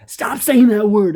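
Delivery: sudden high shout, slow; retold angry yell. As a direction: shout it out of nowhere, high and loud. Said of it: "started yelling out".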